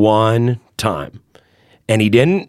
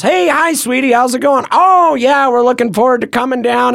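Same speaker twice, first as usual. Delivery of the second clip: high, sweet, chirpy fatherly greeting; dad's sweet phone voice; 10 semitones higher than usual. Retold or imitated. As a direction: pitch way up like a goofy sweet dad. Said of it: "This is really the way my dad talks".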